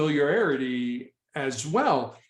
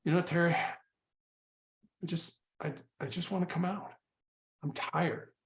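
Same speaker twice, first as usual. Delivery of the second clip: exhausted surrender; 9 dB quieter than usual. Retold or imitated. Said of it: "I had one guy who's just".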